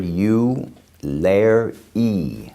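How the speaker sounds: "u, lair e" as three slow, separate syllables; phonetic pronunciation. Shown as a demonstration. Teacher breaking a word down for students, "u, lair e" syllable by syllable. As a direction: sound out "u, lair e" like separate little words. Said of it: "this is called phonetic pronunciation".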